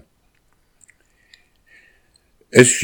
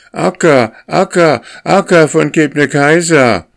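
much higher, loud pleading wail; plaintive goslings; 11 semitones higher, 6 dB louder than usual. A narrator's reading. Hungry goslings pleading with leader Akka, high pitiful wail. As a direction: cry her name up high, pitiful and begging. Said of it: "mit kläglicher Stimme".

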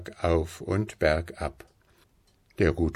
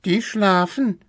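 high-pitched little cat voice; rufende Katze; 12 semitones higher, 3 dB louder than usual. A narrator's reading. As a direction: call it down from the treetop. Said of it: "rief die kleine Katze herunter". (hoch herunterrufendes Kätzchen)

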